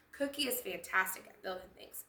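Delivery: ordinary delivery, nothing marked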